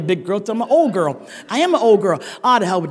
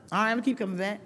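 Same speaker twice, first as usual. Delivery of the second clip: mock reluctant; 10 dB quieter, faster than usual. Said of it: "Why you say it like that?".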